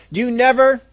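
high, loud, punched "never"; mock accusing nag; accusing complaint. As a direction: fling it like an old grievance. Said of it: "Or we'll go".

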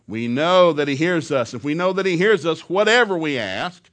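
loud, slow, ringing scripture; preached scripture. Acted. Preacher proclaiming the verse, loud and slow. Reading scripture at full force.

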